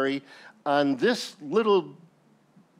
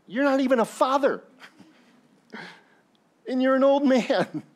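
mocking jeer; 9 semitones higher than usual. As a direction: jeer at the old man, hammed-up. Crowd mocking Abraham's new name.

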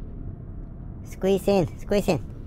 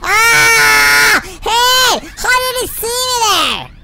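high shouting panic; panicking gamer; 6 dB louder, much slower than usual. Real, not acted. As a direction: yelp as the guard spots you. Spotted by guard, panics.

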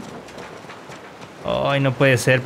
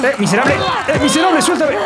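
panicked shout; 10 semitones higher than usual. Real, not acted. Gamer grabbed by an enemy, yelling to break free. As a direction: shout as you get grabbed.

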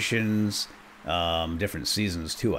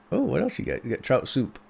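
ordinary talk, nothing marked

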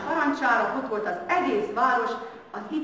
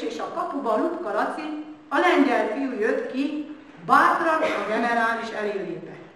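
ordinary delivery, nothing marked

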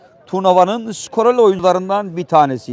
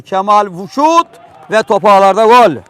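screaming football commentator; 2 semitones higher, 5 dB louder than usual. Real, not acted. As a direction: rise into a shouted goal. Penalty scored, commentator erupts.

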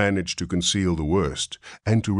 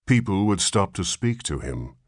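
ordinary delivery

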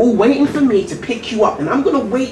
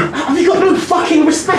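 higher and louder, a furious yell at his dad; angry shout; 8 semitones higher, 3 dB louder than usual. Acted. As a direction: yell it at him, fed up. Son furious at father.

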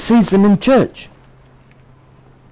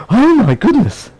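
higher, slow, scandalised churchgoer; mock shock; 7 semitones higher than usual. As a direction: clutch your pearls, slowly and higher. Imagining sinners sitting in church.

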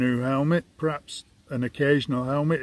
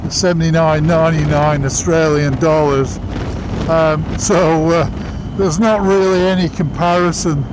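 muffled behind closed visor; talking while riding motorbike; speaking inside a helmet; 6 semitones higher, 12 dB louder than usual. Real, not acted. Seen 3: Rider wears closed full-face helmet with visor throughout; R frames show cap only.

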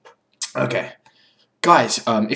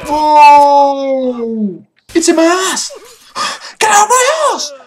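high frantic yelling; panicked screaming; 11 dB louder, slower than usual. Real, not acted. Character stuck, panicked screaming.

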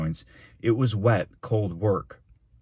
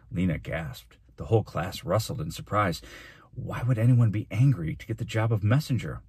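ordinary delivery

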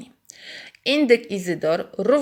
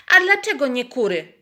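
higher, indignant question; a hen ruffled and offended; mildly indignant clucking hen; 6 semitones higher, 6 dB louder than usual. Acted. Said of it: "oburzyła się gdaczka".

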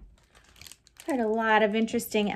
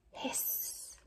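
soft voiceless hiss; 19 dB quieter than usual. Shown as a demonstration. Teacher hissing for the robot alphabet. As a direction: let the air hiss out like a snake.

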